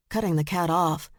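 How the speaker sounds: ordinary delivery, nothing marked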